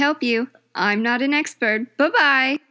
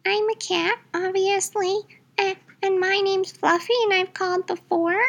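high squeaky little cat character; high-pitched cartoon kitty; cat caller voice; 6 semitones higher than usual. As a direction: play a small cat calling in. Caller plays cat Fluffy.

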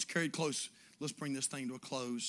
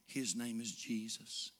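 slow, whispered softly; breathy awe; reverent whisper; 4 semitones lower, 4 dB quieter, slower than usual. Acted. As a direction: hush it like a secret. Whispered within prayer.

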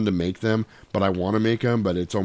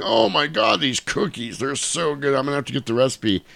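gushing neighbour; 6 semitones higher than usual. Retold or imitated. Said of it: "He's like".

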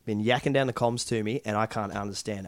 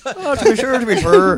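high, loud mock-Irish catchphrase; 11 dB louder than usual. Co-host riffing on the Irish boxing one, mock Irish. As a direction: burst into a hammed-up Irish accent.

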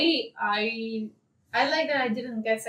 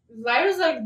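ordinary delivery

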